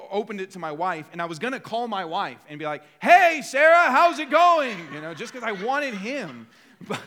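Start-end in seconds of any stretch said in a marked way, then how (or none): 3.0-4.8 high, booming shout into the phone; mock loud phone greeting. Husband calling wife to show up a loud talker, exaggeratedly loud. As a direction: bellow it so the whole coffee shop hears. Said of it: "show him how loud he's being".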